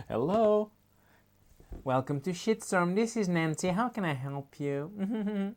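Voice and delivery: high, put-on customer service voice; sing-song receptionist greeting; shop clerk voice. Nancy the Shitstorm clerk greets a caller, in a high put-on voice.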